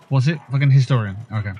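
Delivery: normal talking voice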